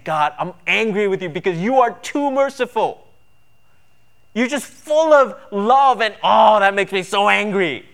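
mock angry complaint. A mocked believer griping to God that he is too merciful, whiny.

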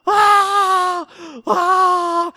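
high-pitched mock scream of pain; imitated child's scream. Son screaming as mom cleans his knee, high mock scream. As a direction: squeal it like a kid hurt by iodine. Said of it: "I hear Isaiah screaming bloody murder".

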